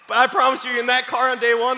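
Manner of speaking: ordinary delivery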